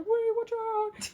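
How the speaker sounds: high, drawn-out mock alarm; alarmed warning. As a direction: stretch a hammed-up warning in a high voice. Acted warning repeated.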